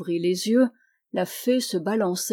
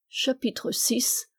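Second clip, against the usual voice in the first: lent, posé, plus doux; annonce de chapitre; 4 semitones higher, 4 dB quieter, much slower than usual. A narrator's reading. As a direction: marque une pause solennelle. (annonce lente du chapitre)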